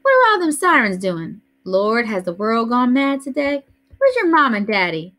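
lightly voiced fussing grandmother; an old lady scolding the noise; Grandma Sands. A narrator's reading. Grandmother fusses at children raising noise early, lightly voiced.

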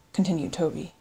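ordinary delivery

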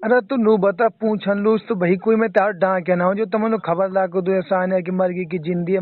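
ordinary talk, nothing marked

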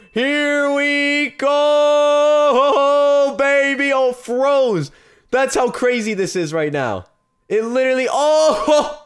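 very high, loud, drawn-out celebration; ecstatic shouting. Real, not acted. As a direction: scream with joy at the big hit. Celebrates the unboxing hit.

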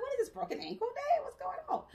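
high, incredulous mock exclamation; mock disbelief. Amazed patient to her audience, mock disbelieving high exclaim. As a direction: throw your voice up in playful disbelief. Sees many broken ankles.

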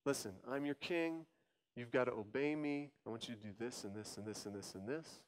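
low, quiet, coaxing king, speeding up at the end; a hushed tempter king laying down orders; lightly voiced seductive ruler; sin king. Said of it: "and he says".

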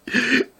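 high giggle; giggling at their own impression. Real, not acted. Storyteller laughing after mimicking the nagging woman, high giggle.

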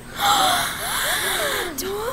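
long, high gasp; audience gasp. A narrator's reading. Audience watching a flying donkey, amazed gasp. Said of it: "The audience gasped".

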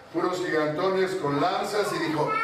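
normal talking voice

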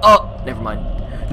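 ordinary talk, nothing marked

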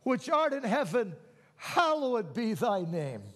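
high, loud exultant preaching, nearly shouting; preaching at full force. Acted. Pastor praising God before the congregation, shouting exultantly. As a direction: proclaim God's greatness to the congregation.

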